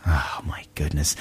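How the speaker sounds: whispered exasperation. Real, not acted. Exasperated aside.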